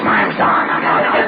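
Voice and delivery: breathy, half-whispered rasp; imitating Don's wrecked voice. Retelling a surgeon's introduction at a meeting, whispery rasp. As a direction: husk it out like a battered man. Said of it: "he had a voice like that".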